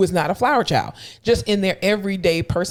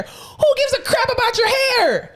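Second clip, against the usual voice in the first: exasperated rant. Acted. Ranting about the OT's hair concern.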